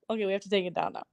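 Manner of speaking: usual speaking voice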